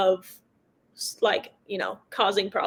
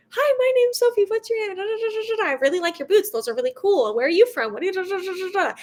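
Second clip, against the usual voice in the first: high, bubbly rapid chatter; chatty pre-race small talk; 8 semitones higher, faster than usual. Retold or imitated. Racer chatting up her rivals at the gate, high and bubbly. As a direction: babble at them like an eager new friend. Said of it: "I'm just gonna".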